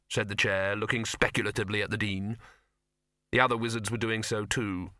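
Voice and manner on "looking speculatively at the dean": plain reading voice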